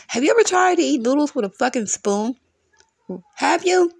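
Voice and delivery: high-pitched, exasperated questioning; incredulous challenge. Real, not acted. Ranting to listeners about noodles, incredulous. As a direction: demand an answer, pitch jumping up.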